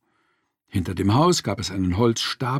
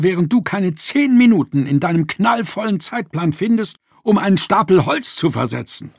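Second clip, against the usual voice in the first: higher, sarcastic, heated accusation; mock his word back at him, then demand an answer; angry; 9 semitones higher, 3 dB louder than usual. A narrator's reading. Wife attacking her husband's busy schedule, heated sarcastic anger. Wife's heated reply in quarrel.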